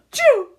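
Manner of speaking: sudden loud high sneeze. A narrator's reading. Sick son sneezing mid-call. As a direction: burst out a sneeze mid-sentence. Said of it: "sneezed a voice".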